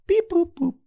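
high beeping robot voice. Retold or imitated. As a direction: chirp it like a machine.

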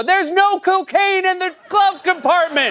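high, slow, nervous fib; squeaky innocent act, badly hiding it; guilty driver voice. Driver lying to a cop.

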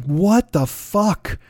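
a slow, drawn-out what the fuck; stunned disbelief. Retold or imitated. Fan hearing the album in the car, drawn-out stunned disbelief. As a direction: let the shock stretch every word out. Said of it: "I'm like".

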